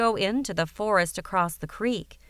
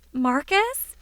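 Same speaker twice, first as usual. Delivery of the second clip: concerned; 6 semitones higher than usual. Acted. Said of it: "asked in concern".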